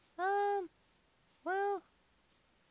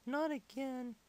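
ordinary delivery

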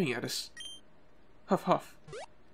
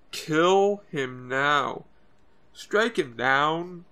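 slow menacing urging; much slower than usual. Acted. Team Rocket urging kill, slowed.

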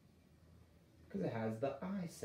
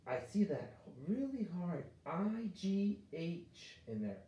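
slow, higher and wondering; slow discovery; 5 semitones higher, slower than usual. Shown as a demonstration. Teacher spotting the IGH to the class, slow and wondering. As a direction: draw it out as if just noticing.

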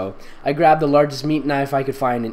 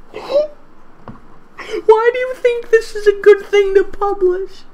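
very high, slow disbelieving question; incredulous whine; 3 dB louder, much slower than usual. Real, not acted. Reader baffled that someone published this.

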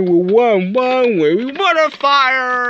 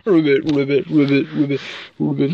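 frog ribbit; 6 semitones lower, faster than usual. Retold or imitated. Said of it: "That says ribbit".

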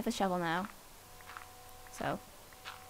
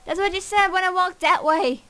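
exasperated; 7 semitones higher, 6 dB louder than usual. Real, not acted. Annoyed complaint.

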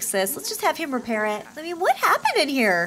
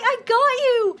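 high, gleeful mock taunt; high sing-out of playful victory; playful gloating; 10 semitones higher, 4 dB louder, slower than usual. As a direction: voice a triumphant teasing sister. Voices gleeful Sim after hit.

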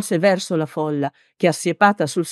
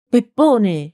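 raised, higher-pitched call by name; mild narrated shout; shouted in the ear; 5 semitones higher, 4 dB louder than usual. A narrator's reading. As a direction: call him sharply to attention. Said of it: "gli gridò nell'orecchio il brusco".

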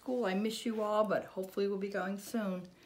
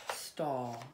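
ordinary delivery

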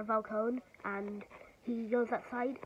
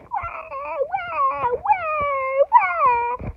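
high, crying, getting louder each time; imitated sobbing; 10 dB louder, much slower than usual. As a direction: wail it like a broken villain. Riddler sobbing in his cell.

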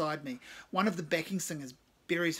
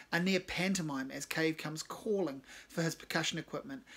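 usual speaking voice

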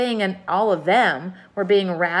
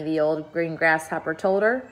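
usual speaking voice

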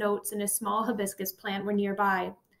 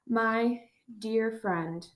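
very slow, worn-out greeting; each word costs him breath; weak dying voice; much slower than usual. Acted. Dying professor to his old student, faint and slow.

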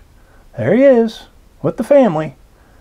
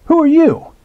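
put-on child's question; 12 semitones higher, 4 dB louder than usual. A narrator's reading. Child asking the stranger.

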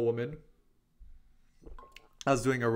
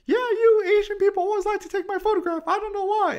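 much higher, louder mock voice of the lady; shrill-free caricature, pitched way up and loud; imitating the woman; 9 dB louder than usual. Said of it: "And she goes".

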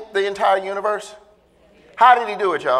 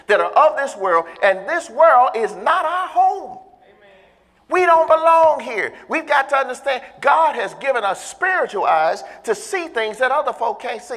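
full-force preaching; 4 semitones higher than usual. Acted. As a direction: preach it to the congregation.

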